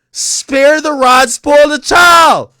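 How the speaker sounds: loud, high, slow proclamation; shouted saying. Real, not acted. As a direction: bellow the proverb like a verdict. Repeating saying, now shouted.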